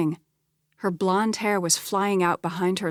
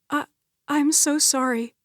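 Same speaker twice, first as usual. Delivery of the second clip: higher, cracking apology; voice breaking; 8 semitones higher than usual. Acted. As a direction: let the voice break on sorry. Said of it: "Her voice broke".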